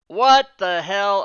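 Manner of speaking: sudden loud slow exclamation, real surprise; startled what the hell. Real, not acted. Streamer reacting to his own screen, startled exclamation. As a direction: caught off guard by something on screen, blurting it out.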